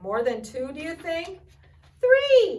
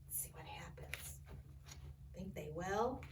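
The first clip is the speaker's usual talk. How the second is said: hushed whisper to the listeners; suspense whisper; 3 semitones lower, 13 dB quieter than usual. Real, not acted. Reader builds suspense for kids.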